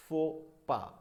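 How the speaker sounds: "faux pas" as foh, pah, silent endings; correct "faux pas". Shown as a demonstration. Teacher reading out the Hindi spelling, correct pronunciation. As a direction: say "faux pas" in two open syllables. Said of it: "Hindi में भी लिखने की कोशिश".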